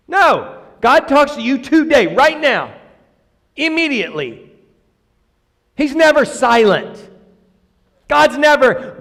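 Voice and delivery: high, insistent preacher's rebuttal; emphatic preaching. Real, not acted. Preacher refuting the idea God delays, high and insistent.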